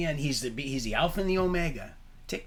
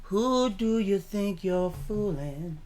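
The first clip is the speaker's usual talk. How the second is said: mock taunting shout; 6 semitones higher, slower than usual. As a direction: taunt it, dragging the words out. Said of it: "I said".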